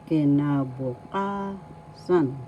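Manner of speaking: "kinabukasan" slowly, with a long, held KA; stress on ka. Shown as a demonstration. Teacher showing learners the accent, slow "kinabukasan" stressed on KA. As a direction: draw out the fourth syllable of "kinabukasan", then drop on "san". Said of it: "The fourth syllable ka has the accent".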